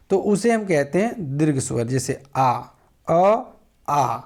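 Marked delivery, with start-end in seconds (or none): 3.1-3.4 अ clipped short, half the length. Shown as a demonstration. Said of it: "देखिए अ में आधा समय लगा". 3.9-4.2 आ drawn out, double length; long aa. Shown as a demonstration. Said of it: "आ में ज़्यादा समय लगा".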